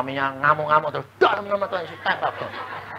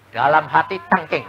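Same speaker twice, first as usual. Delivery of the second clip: ordinary talk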